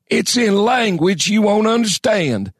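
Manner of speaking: put-on high, cracked old codger; gravelly old man voice. A narrator's reading. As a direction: rasp it like an ancient prospector. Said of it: "harsh and gravelly".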